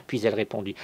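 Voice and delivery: plain reading voice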